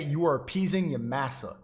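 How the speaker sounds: ordinary talk, nothing marked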